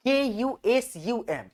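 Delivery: letters spelled out slowly one by one; slow dictation to students; slow spelling. Shown as a demonstration. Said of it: "spelling letters out for students".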